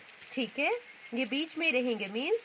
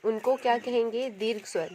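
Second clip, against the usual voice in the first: ordinary delivery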